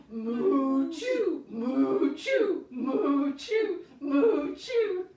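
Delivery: loud mooing sneezes, ending in a laugh; cow sneeze. Shown as a demonstration. Teacher plays a sneezing cow for kids, loud moo-sneeze. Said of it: "cow is going to sneeze".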